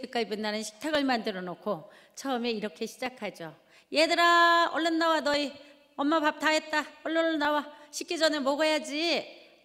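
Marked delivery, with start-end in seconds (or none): 3.9-9.6 calling loud and high, meant as gentle; a gentle call that comes out loud and high, slowing at the end; soft and gentle. Retold or imitated. Mother calling kids to dinner, meant gently, actually loud and high. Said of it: "부드럽고 잔잔하게 말하면".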